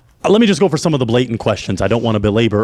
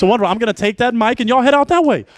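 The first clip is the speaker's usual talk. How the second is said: hype host; 8 semitones higher than usual. Acted. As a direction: pump up the crowd like an MC closing the show. Host wraps up the show.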